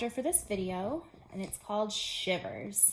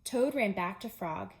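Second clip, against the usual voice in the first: usual speaking voice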